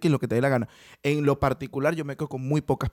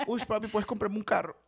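higher, wheedling Colombian woman; mock Colombian accent; 6 semitones higher, 4 dB quieter than usual. Colombian woman asking for a car, higher accented coaxing. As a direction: coax lightly in a pitched-up Colombian accent. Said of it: "una colombiana me dice".

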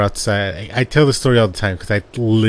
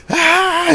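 very high, held mock scream; imitated scream; 4 dB louder than usual. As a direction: imitate a sister screaming in horror. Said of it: "She's screaming her head off".

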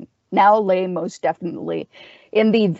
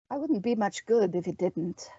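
waspish; 8 dB quieter than usual. Acted.

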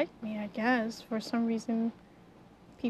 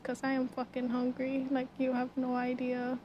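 ordinary delivery

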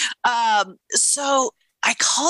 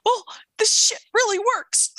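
amazed; 11 semitones higher than usual. Retold or imitated. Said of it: "I'm like".

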